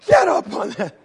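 high, loud mock yell; acted motherly shout; barked order. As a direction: order him up like an angry mom. Mother orders son to climb.

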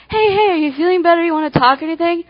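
quick, high-pitched friendly concern; acted perky, hurried questions; eager checking-in. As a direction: rush up hopefully to a moody friend.

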